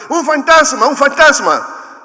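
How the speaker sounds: high, loud panicked cry of fishermen; terrified shout. A narrator's reading. Frightened disciples in the boat seeing a ghost, shouting in terror. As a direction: scream it like you've seen a ghost. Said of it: "Y llenos de miedo gritaron".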